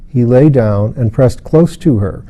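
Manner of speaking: plain reading voice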